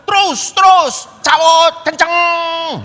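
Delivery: high, loud shouts to speed on. Retold or imitated. Anton on the back seat yelling at the rider, loud and high. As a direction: urge the rider on hard.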